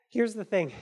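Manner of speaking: usual speaking voice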